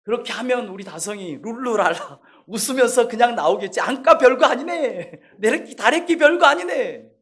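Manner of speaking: father imagining an easy visit, light and laughing; cheerful inner thought. Retold or imitated. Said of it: "저는 속으로".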